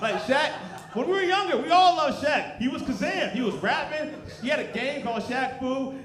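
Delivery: high, loud gushing nostalgia; excited. Real, not acted. Excited nostalgia about Shaq.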